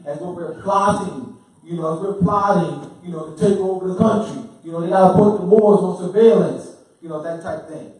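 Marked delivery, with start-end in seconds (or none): 0.1-6.8 building to a loud, mock-alarmed accusation; indignant mimicry. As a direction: get louder with each accusation, mimicking the accusers. Mimicking accusers, voice rising to a shout.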